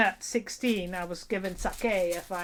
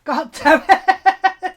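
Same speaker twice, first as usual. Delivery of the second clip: louder and higher, slow and stretched out; frustrated drawn-out groan; 6 semitones higher, 12 dB louder, much slower than usual. Real, not acted. Giving up on the word, loud slow groan. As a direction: stretch out God damn it in exasperation.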